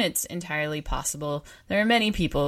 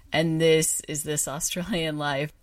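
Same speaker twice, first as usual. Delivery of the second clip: ordinary talk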